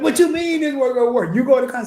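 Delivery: mama's indignant question. Retold or imitated. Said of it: "She said".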